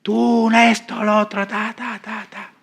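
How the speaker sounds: possessed-acting girl cursing the pastor, mocked. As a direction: spit out vague curses in a put-on voice. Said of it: "a maldecir".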